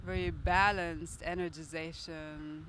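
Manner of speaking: calm, steady guiding voice; guided meditation. Acted. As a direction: guide the breathing gently. Leads a guided breath meditation.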